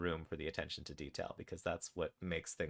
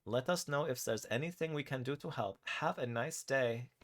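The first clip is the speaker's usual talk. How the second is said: put-on higher German-accented banker, slowing at the end; 6 semitones higher than usual. German woman ending the bank call, German accent. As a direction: sign off sweetly in a German accent. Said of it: "says the German woman on the phone".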